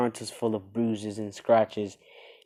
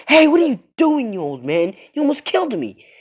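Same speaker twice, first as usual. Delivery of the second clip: high, loud indignant shout; outraged, much higher and louder; angry yell; 14 semitones higher, 11 dB louder than usual. Acted. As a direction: scream at the old man for nearly killing you. Naruto yelling at the master.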